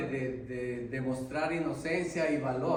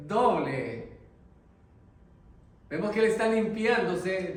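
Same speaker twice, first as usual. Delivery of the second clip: louder, higher preaching build; full-force preaching; 5 semitones higher, 5 dB louder than usual. Acted. Preacher exhorting his brothers about the converted jailer, fervent loud preaching. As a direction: drive the point home to the congregation.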